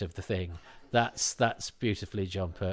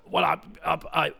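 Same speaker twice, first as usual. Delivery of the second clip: lightly voiced insistent drinker; loud, higher, proud promise not to drink; defiant drinker; 4 semitones higher, 5 dB louder than usual. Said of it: "that thing that all drinkers do, go".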